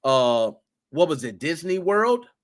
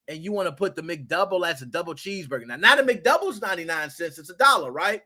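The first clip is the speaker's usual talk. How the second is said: ordinary delivery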